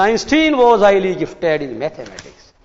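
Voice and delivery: normal talking voice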